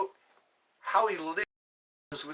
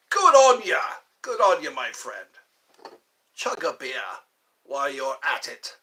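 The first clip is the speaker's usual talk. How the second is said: mock Aussie accent; 9 dB louder than usual. Mock Aussie toast to a drinking chatter. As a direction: cheer like an Australian mate.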